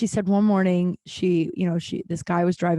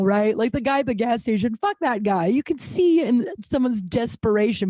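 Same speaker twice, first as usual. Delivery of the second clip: usual speaking voice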